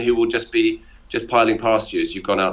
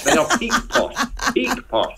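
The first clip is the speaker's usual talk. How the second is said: much higher, laughing delight; excited laughing verdict; 12 semitones higher than usual. Real, not acted. Guest crowning red chinos the height of posh, high laughing glee.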